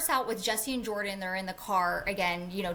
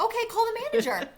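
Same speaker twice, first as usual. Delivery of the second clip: high, fast and fed up; exasperated; 12 semitones higher, faster than usual. Retold or imitated. Retelling to a friend, telling the clerk off exasperated. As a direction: snap it out, done with her.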